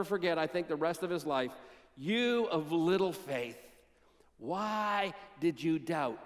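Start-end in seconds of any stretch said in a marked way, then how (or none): 1.9-6.2 gentle rebuke. Acted. Preacher voices Jesus' gentle rebuke.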